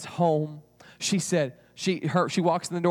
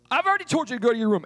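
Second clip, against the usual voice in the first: loud, high, rapid scolding; exasperated mom; 7 semitones higher, 5 dB louder, much faster than usual. Acted. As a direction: rattle off the order, fed up. Mother scolds child for not leaving.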